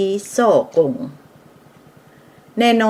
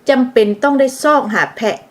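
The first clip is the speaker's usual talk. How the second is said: ordinary talk, nothing marked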